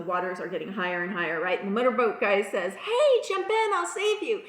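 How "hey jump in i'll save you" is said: high, hearty imitated rescue call. Motorboat guy to man on the roof, calling out. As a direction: call across the water, pitched up.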